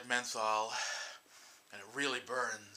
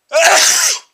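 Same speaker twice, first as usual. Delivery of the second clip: loud sudden sneeze; big sneeze; 26 dB louder than usual. Real, not acted. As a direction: sneeze hard mid-sentence. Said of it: "those were big sneezes".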